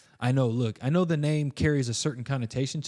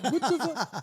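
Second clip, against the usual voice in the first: baffled; 11 semitones higher, slower than usual. Retold or imitated. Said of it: "I'm just like".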